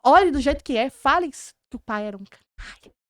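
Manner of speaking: loud, driving command to let it all out; forceful urging. Retold or imitated. Therapist pushing a client to speak the truth: loud insistent urging. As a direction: push them hard to say it.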